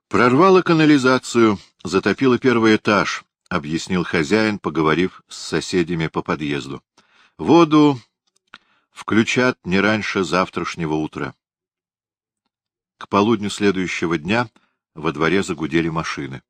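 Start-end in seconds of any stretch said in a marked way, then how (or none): none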